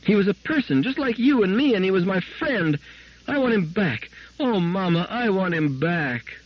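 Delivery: put-on high, fierce child pleading, slowing at the end; a little girl fighting for her friend, near tears; fierce tearful child. A narrator's reading. Daughter defending her robot to her mother, tearful. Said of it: "screamed Gloria, fiercely".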